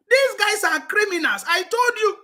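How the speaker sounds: heated. Real, not acted. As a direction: accuse them hotly. Heated accusation.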